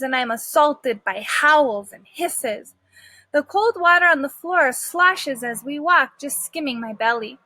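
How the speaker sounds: usual speaking voice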